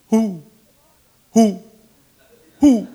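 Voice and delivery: a light owl hoot. Retold or imitated. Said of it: "And he goes".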